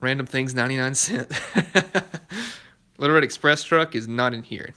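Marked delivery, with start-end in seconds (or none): 1.4-2.5 laugh. Real, not acted. Laughs at ninety-nine cent donation.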